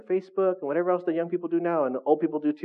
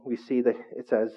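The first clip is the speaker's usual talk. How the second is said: normal talking voice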